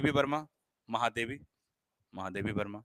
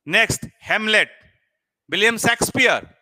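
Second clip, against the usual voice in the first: loud, high call; loud announcement; 6 semitones higher, 11 dB louder than usual. Shown as a demonstration. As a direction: announce the next title. Announces next item loudly.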